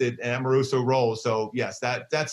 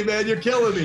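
laughing; 8 semitones higher, 4 dB louder than usual. Real, not acted. Laughing at the teasing.

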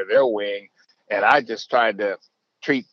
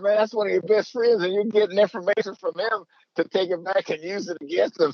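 imitating Mike, teasing; 10 semitones higher than usual. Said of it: "he said".

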